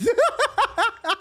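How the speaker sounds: high-pitched cackling laughter; real laugh. Real, not acted. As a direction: burst into high, rapid laughter. Laughing at his own story.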